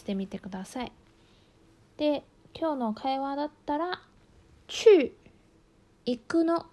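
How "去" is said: 去 sharply falling from high, said loud; 去 punched down from the top of the voice; fourth tone demo. Shown as a demonstration. Teacher showing the fourth tone on 去, loud and falling. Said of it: "去は四声です".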